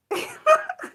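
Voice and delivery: high, shrill laughing squeal; shrieking laugh. Real, not acted.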